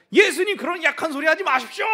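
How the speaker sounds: high, loud indignant rebuke; Peter angry. Retold or imitated. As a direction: snap back at Jesus in outrage. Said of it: "벌컥 화를 내면서".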